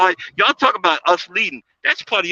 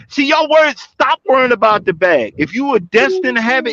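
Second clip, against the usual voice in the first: usual speaking voice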